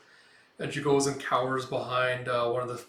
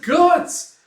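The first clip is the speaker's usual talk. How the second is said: high, very loud cry; piercing, desperate yell; alarmed scream; 14 dB louder than usual. Retold or imitated. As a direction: shriek your friend's name in alarm. Acts Puck screaming friend's name.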